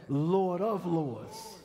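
drawn out, weighty words; slow proclamation. Acted. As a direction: stretch each word like a proclamation. Preacher completes the refrain.

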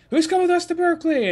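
high, loud, fast call to the group. Retold or imitated. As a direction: shout the invite across the room, fast. Calling out to coworkers.

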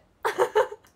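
a short, very loud high burst of laughter. Real, not acted. Laughing at the repeated remark. (大きく高い笑い声)

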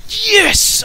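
triumphant high yell; excited shout. Real, not acted. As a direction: cheer the win loudly. Celebrating a kill.

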